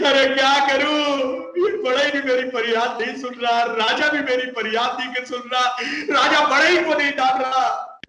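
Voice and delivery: high, whiny despairing parrot; a hammed-up tearful lament; mock crying parrot. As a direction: parrot wailing that nobody will hear its plea, high and whiny. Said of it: "रोते रोते तोता".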